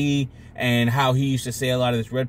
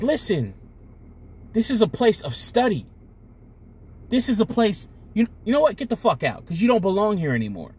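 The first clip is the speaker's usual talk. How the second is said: higher-pitched emphatic scolding, lightly acted; stern ultimatum; 7 semitones higher than usual. Society's librarian throws out people still arguing, stern ultimatum. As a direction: lay down the rules and dismiss them.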